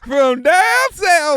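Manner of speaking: loud, high, drawn-out Southern drawl; Sandy Cheeks. Acted. Cartoon lady giving her origins, big Southern drawl. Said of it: "it's Sandy Damn Cheeks".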